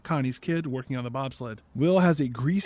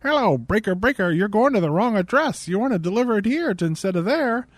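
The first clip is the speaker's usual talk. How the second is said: higher, louder CB radio voice; put-on trucker voice; 5 semitones higher, 4 dB louder than usual. Woman faking a trucker to a driver, put-on radio voice. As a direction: fake dispatcher calling over the radio. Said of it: "She's putting on a voice like".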